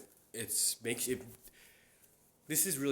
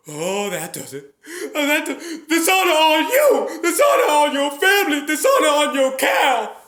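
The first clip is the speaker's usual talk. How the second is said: very high, loud, screaming cartoon dragon; Mushu impression; 20 dB louder than usual. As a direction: rant furiously like a little dragon. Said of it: "So that's my Mushu".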